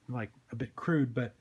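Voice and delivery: ordinary delivery, nothing marked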